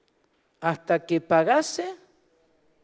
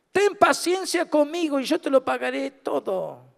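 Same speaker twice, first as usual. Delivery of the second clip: pleading debtor; 7 semitones higher than usual. Acted. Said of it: "le rogaba diciendo".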